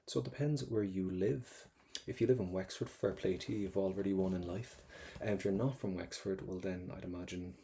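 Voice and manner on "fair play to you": ordinary talk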